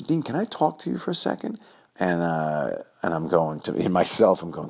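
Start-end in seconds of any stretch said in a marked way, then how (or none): 0.0-1.6 high, quick request from the teacher; a hint of a high teacher voice; teacher asking. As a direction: ask it like the teacher pulling him aside. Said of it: "she says".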